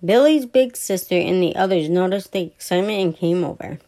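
ordinary delivery

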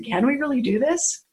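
doubtful question with a rising end; inward wondering. Retold or imitated. Sisters doubting in their hearts after the call, wondering. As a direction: wonder it privately, without the pep. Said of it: "in our hearts we're wondering".

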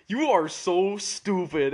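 slow, drawn-out fed-up complaint; mock exasperation. Classmate fed up at having paper grabbed, mock exasperated. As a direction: stretch each word in mild mock outrage.

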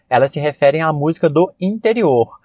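ordinary talk

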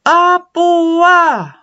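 very loud, very high, longer held cry; stretched, falling full-force call; shout at the top of its lungs. Acted. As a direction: yell Apua with everything the throat has. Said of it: "huusi niin lujaa kuin kurkusta lähti".

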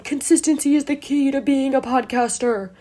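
preachy advice voice. Retold or imitated. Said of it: "all the people out there are like".

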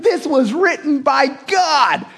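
high, slow, shouted proclamation; excited Moses. Retold or imitated. Moses coming down the mountain, slow high shout. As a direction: hold it up and shout it slowly.

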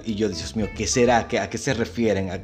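ordinary talk

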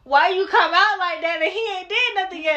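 high, loud, incredulous questioning; retold challenge. Retelling asking a friend about coming out swinging, high challenge.

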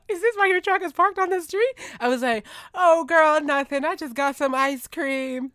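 high, loud flustered answers; nervous high reply. Retold or imitated. Speaker brushing off a nosy stranger, high and flustered. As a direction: play it off brightly while nervous.

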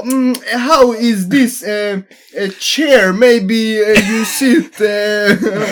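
slow, high groping English with hesitations; mock learner English, searching for words; broken English. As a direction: fumble for each English word. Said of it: "Man bara".

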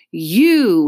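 loud, drawn-out accusation; booming. A narrator's reading. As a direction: boom it out at the culprit. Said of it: "he boomed".

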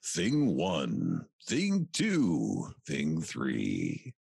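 polished voiceover read of numbered segments; podcast announcer interstitials. Shown as a demonstration. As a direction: announce each item like a show bumper. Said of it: "little interstitials that come in between them saying".

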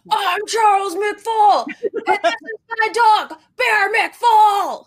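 high, slow, shouted rock-star voice; rock god impression. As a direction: belt it out like an arena rock singer. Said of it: "in imitations of the rock god".

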